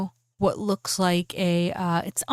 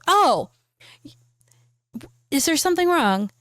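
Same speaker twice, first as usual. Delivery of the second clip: higher, louder "oh" into the question; surprised query; 7 semitones higher, 3 dB louder than usual. Acted. Boss to the secretary who interrupts his meeting, surprised query. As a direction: act startled by an interruption.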